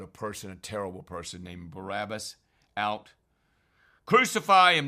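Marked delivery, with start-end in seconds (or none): 4.0-4.9 loud, higher mock crowd yell; crowd's shout. The crowd demanding Jesus be crucified, loud yell. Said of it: "they said".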